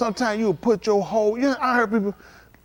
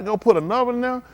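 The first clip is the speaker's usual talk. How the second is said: ordinary talk, nothing marked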